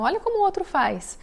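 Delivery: high, pointed comparing tone; a parent nudging the child to copy another kid. Retold or imitated. Mother telling her child to copy another kid, in a high pointed voice.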